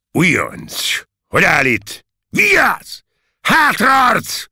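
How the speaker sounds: loud, high shouted orders; rising parade-ground shouting; drill-sergeant commands. A narrator's reading. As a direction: bark commands, building to a yell.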